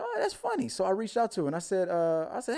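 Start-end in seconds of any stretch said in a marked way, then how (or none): 0.0-0.7 mock high reaction. His own reaction retold.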